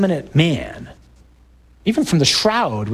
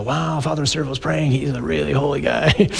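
awed parishioner. Retold or imitated. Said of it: "you look at us and go".

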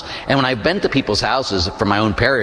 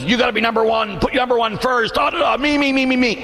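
high, frantic me-first chant; hammed-up, pitched-up selfish urging; voice of the world; 9 semitones higher than usual. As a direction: push it high and insistent, building to shouted ME's. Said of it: "The world says".

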